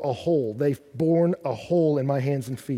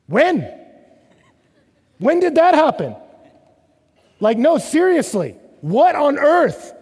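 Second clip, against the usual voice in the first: loud high what-happened disbelief; astonished; 10 semitones higher, 6 dB louder than usual. Acted. Preacher marvelling at the sudden rescue, astonished. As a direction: gasp it in disbelief.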